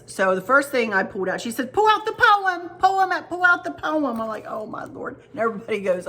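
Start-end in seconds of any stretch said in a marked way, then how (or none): 1.7-4.0 much higher, louder, urgent mock pleading; imitating an eager friend. Friend eagerly urging her on. 4.5-5.2 breathy, unvoiced whisper of mock dread; whispered oh my Lord. Overwhelmed aside on seeing poem.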